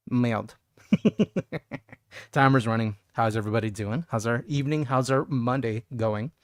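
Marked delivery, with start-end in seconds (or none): none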